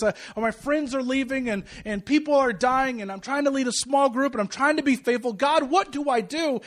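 retold desperation, troubles piling up; overwhelmed plea. Young father begging God for direction, overwhelmed.

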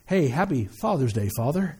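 mock a card that's too proper for your dad; stiff formal greeting. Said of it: "it sounds a little weird".

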